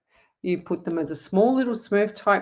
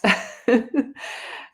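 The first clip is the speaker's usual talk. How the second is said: embarrassed laugh; 4 semitones higher than usual. Real, not acted. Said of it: "Oh my God".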